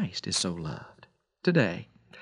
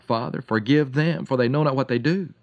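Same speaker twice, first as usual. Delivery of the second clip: ordinary delivery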